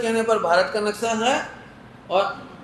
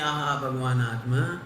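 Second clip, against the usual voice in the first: softer, slower mock rapture; mock pious exclamation; 8 semitones lower, 5 dB quieter, slower than usual. Preacher mocking scholars' lofty talk at lectures, lightly. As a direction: lightly ham up the devotee's piety. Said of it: "प्रवचन करते समय तो कहते हैं".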